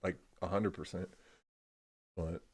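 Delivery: ordinary talk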